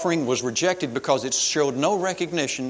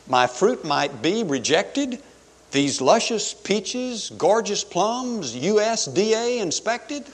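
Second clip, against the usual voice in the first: slow, high-pitched wounded pride; affronted Cain; 8 semitones higher, 3 dB louder, slower than usual. Acted. Cain hearing his fruit might be rejected, indignant disbelief. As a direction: draw out each item in disbelief. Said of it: "this is the way it would have sounded to me".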